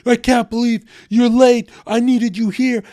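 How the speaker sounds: high, loud complaining; mock angry buddy yelling about lateness; mock angry friend. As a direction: imitate the buddy lashing out. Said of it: "lash out at one of us".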